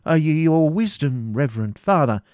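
high, loud, halting start; flustered stammer. A narrator's reading. Preceptor nervously answering the Grand Master, stammering. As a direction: stumble to appease him.

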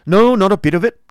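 high, loud tart retort. Acted. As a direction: snap it back tartly. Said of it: "rejoined Squeers tartly".

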